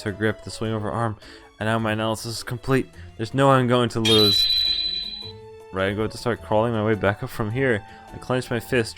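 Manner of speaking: ordinary talk, nothing marked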